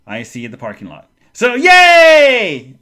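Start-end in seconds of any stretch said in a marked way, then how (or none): 1.3-2.8 loud, high sarcastic cheer; mock joy. Guest finding parking lot view, sarcastic loud cheer. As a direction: shout a drawn-out fake hooray. So that was joyful.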